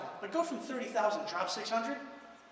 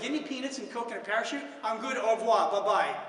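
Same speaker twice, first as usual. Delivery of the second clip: mock panic exit; 2 semitones higher, 7 dB louder than usual. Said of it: "push the panic button and say".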